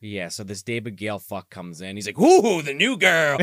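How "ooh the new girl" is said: loud, drawn-out, higher mock delight; mock leering customer. Customer spots the new girl, mock leering. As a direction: draw out a hammed-up "ooh" at the newcomer. Acting the customer seeing her.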